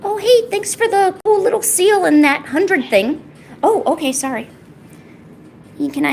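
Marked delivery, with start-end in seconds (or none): none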